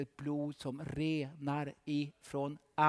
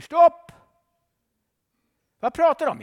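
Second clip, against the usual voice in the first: high, loud rebuke; shouted stop; 13 semitones higher, 16 dB louder than usual. Acted. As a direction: shout him down. Preacher rebukes the devil.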